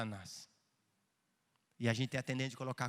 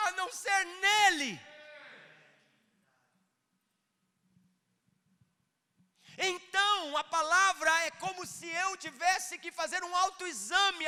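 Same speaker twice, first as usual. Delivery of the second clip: higher, louder preaching with shouted peaks; fiery pulpit preaching, voice raised and cracking out loud; preaching at full force; 7 dB louder than usual. Acted. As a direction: preach it hard, building to shouts.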